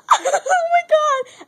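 very high, drawn-out squeal; retold excited scream. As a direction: shriek it slowly like an overwhelmed fan. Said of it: "And I screamed really loud".

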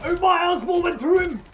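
high furious yell; raging shout. Real, not acted. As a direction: yell out in disbelief. Shouting about game hit.

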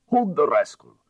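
urgent shout. A narrator's reading. Detective calling mid-struggle.

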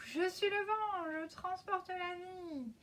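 slow, high and proud; grand proclamation. Acted. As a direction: declare it like the wind. Fou proclaims himself the wind.

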